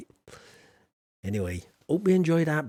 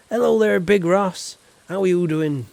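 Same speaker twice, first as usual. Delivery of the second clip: high, loud, sing-song hello; booming hello to a new viewer; loud cheery greeting; 5 semitones higher, 8 dB louder than usual. Acted. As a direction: call out a big friendly welcome.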